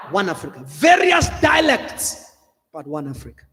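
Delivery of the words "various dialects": preaching shout. Real, not acted. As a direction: stretch it out and shout it to the crowd. Preacher stressing a point.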